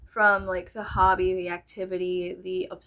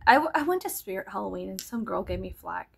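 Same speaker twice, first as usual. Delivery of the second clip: normal talking voice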